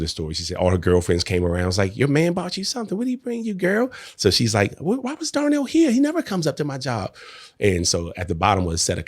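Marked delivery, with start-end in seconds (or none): 2.0-3.9 much higher, playful girlfriend imitation; teasing girlfriend voice. Girlfriend teasing Tracy about her gift, high playful voice. 4.8-7.1 much higher, puzzled imitation of his wife; surprised wife. Wife wondering why husband came to her job, high surprised voice.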